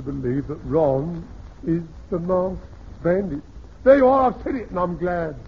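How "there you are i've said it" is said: dramatic outburst. Acted. Dramatic confession of the accusation.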